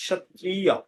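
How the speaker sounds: সত্রিয়া starting with sh, ending in ya; Sanskrit pronunciation. Shown as a demonstration. Teacher contrasts it with Bengali, সত্রিয়া with sh. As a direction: say সত্রিয়া as shat-triya. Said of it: "এরকম উচ্চারণ হবে".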